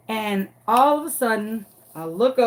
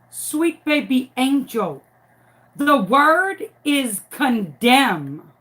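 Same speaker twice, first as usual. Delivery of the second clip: slow, deliberate teacher correction; 3 semitones higher, 3 dB louder, much slower than usual. Retold or imitated. Teacher slowly correcting the boy's word, deliberate and measured. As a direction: spell it out slowly to the child.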